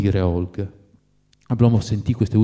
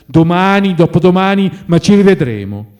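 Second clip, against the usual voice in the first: loud, high, emphatic; raised voice, piling up the days; insistent pleading; 8 semitones higher, 8 dB louder than usual. A narrator's reading. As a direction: insist on meeting, whatever the day. Friend pleading to meet again.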